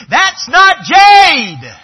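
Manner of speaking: high, very slow, loud outcry; drawn-out shocked cry of "That's not jade!". Retold or imitated. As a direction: blurt it out like a boy catching the fake. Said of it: "the boy cried out instinctively".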